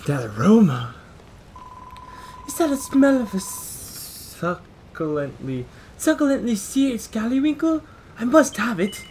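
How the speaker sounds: loud, high, hungry character; greedy character voice. Acted. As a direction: ham up the food craving. Greedy character smelling food.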